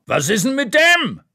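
high, loud bellow from behind the bar; man behind the bar yelling across the restaurant; shouting across the room. Acted. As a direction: yell at the waitress across the room. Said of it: "schreit ein Mann".

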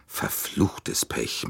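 low, drawn-out curse under the breath; slow, hushed, exasperated swearing; venting annoyance. A narrator's reading. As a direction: let the frustration out through a stretched, voiceless curse. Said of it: "machte Monsieur Bouc seinem Ärger Luft".

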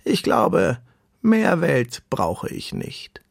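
slow turtle, starting high and settling down; deep-voiced turtle. A narrator's reading. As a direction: conclude it placidly, drawing words out. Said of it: "eine tiefe Stimme".

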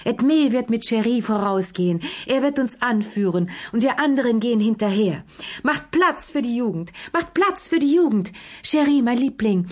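high, fluttery, effusive directing; delighted hostess making a fuss; gushing excitement. A narrator's reading. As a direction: marshal everyone in an excited high voice. Gushing hostess directing the young couple.

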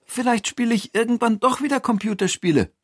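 high, quick character voice; relenting Toni. Acted. Toni reconsiders playing games, high and quick. Toni's figure voice.

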